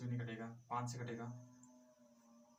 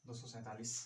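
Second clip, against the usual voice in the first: number read softly to the board; quiet, offhand read of the number; quiet first reading; 4 semitones lower, 5 dB quieter than usual. Shown as a demonstration. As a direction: softly say दो सौ सैंतालीस as if writing it down. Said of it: "जैसे".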